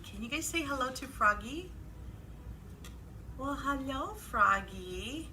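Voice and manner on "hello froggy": ordinary delivery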